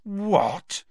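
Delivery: can't believe what she said; disbelief. A narrator's reading. Ron reacts to Hermione, disbelieving. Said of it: "said Ron in disbelief".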